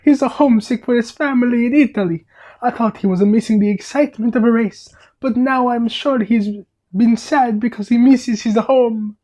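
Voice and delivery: put-on high, animated Italian-accented Luigi. Luigi explaining to the cars why Guido is sad, Italian accent. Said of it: "rate my Italian accent".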